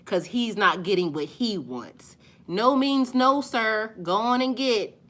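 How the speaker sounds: usual speaking voice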